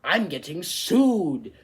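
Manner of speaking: loud, high, drawn-out phone announcement; loud embarrassing alert, stretched on "sued"; phone announcing publicly. Acted. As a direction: blare it out slowly for everyone to hear. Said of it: "the phone says in front of everybody else".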